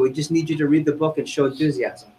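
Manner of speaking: ordinary talk, nothing marked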